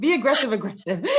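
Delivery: high, loud, laughing; laughing punchline. Real, not acted. Interviewee jokingly ends her advice to the interviewer, laughing. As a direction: crack yourself up delivering the joke.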